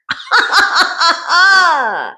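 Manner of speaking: big burst of real laughter; cackling at the enemy's fate. Real, not acted. Laughing after naming an enemy.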